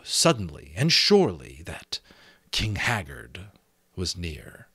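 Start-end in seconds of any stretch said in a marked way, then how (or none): none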